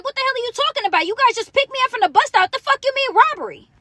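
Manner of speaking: high, incredulous retold protest; confused protest. Arrested woman answering the detective about robbery, confused and protesting. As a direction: throw the accusation back in disbelief. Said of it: "I was so confused on this day".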